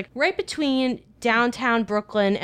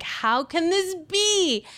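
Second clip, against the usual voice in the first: high, drawn-out wail; dismayed disbelief; 10 semitones higher, much slower than usual. Retold or imitated. Wife hearing husband's shaking voice, dismayed. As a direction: stretch it high, acting out dismay.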